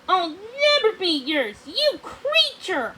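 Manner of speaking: high, slowing defiant cry; defiant retort. A narrator's reading. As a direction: fling it back at him in open defiance. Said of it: "Odette shot back".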